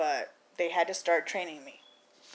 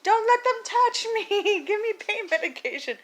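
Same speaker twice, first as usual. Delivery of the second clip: hammed-up high-pitched yell; mock screaming; 13 semitones higher, 4 dB louder than usual. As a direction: reenact a panicked scream, pleading and stretched. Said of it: "says that I was screaming".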